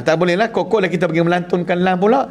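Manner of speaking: ordinary delivery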